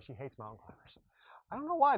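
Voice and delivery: quick hushed whisper so she won't hear; whispered aside. Real, not acted. Coach whispering to the camera about a member. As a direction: lean in and whisper the secret.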